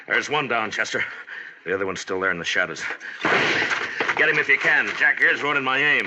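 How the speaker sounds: urgent shout to his partner; shouting in a gunfight. Acted. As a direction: yell it over the gunfire. Gunfight, marshal calling out.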